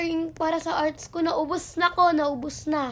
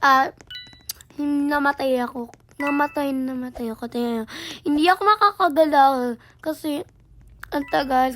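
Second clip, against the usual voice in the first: usual speaking voice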